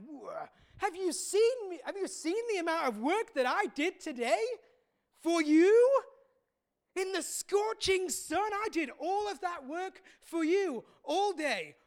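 stamp your feet at the injustice; aggrieved worker shouting at the master; furious rant. Acted. Big worker rants at master.

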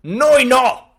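hammed-up loud refusal; mock defiant shout. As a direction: shout it out proudly. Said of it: "lui fa".

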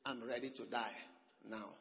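low, very soft dying croak; faint croaking whisper of old Paul. Acted. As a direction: croak out a dying man's last breath. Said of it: "With his croaking voice".

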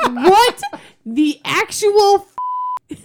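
high, loud, stunned what-the; shocked outburst. Retold or imitated. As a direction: blurt it in disbelief, breaking off. Shocked at kid's remark.

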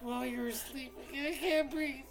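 high, soft sobbing complaint; imitated tearful girlfriend; mock crying. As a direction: whimper it as if gasping through tears. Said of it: "You're crying over a fart".

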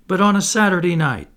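high, loud, coaxing hint; insinuating. A narrator's reading. Slightly coaxing Wendy to allow dancing, insinuating. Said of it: "Slightly insinuated".

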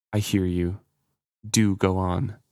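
flat, emotionless reply; expressionless. Acted. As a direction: hold all feeling out of the voice. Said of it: "He kept all expression out of his voice".

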